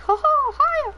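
high squeaky cartoon mouse greeting; Mickey Mouse voice. Shown as a demonstration. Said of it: "Like, I can go".

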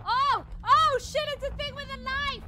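very loud high shocked scream; terrified scream. Real, not acted. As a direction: scream at the sudden attack. Jumpscare by knife creature.

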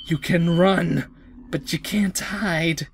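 low, slow taunt fading to a whisper; creepy killer. Acted. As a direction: stalk her softly, then whisper the threat. Killer taunts while she hides.

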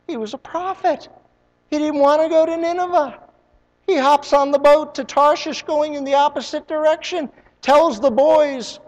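high, loud preacher's build; full-force pulpit preaching. Acted. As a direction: preach it out, driving the story. Preaching Jonah's story.

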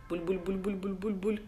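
soft imitated boiling bubbles; quiet bul-bul boiling noise; bubbling sound. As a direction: make the pot bubble.

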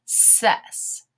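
Ses alone, with hissed S sounds; isolated syllable Ses, C as S; sounding out. Shown as a demonstration. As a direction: stretch the S in Ses. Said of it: "gonna say the S sound".

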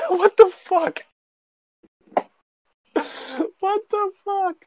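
very high, squeaked what the fuck; incredulous squeak through a laugh; squeaky disbelief. Real, not acted. Reacting to the drunk gamer story with a high squeak of disbelief.

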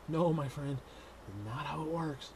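ordinary delivery

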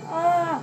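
sudden yelp at turbulence; short startled shout; startled cry. Real, not acted. Turbulence hits the plane.

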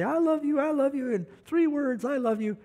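high-pitched mock drunken call up to the window; lightly hammed drunk calling upstairs to his wife; drunk husband bellowing. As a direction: ham up a drunk pleading to be let in. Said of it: "he'd bellow upstairs to her window".

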